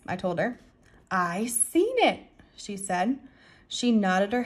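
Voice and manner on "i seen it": put-on slow small-child voice; little girl. A narrator's reading. Little girl nodding at the narrator, slow child voice. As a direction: draw it out like a young child.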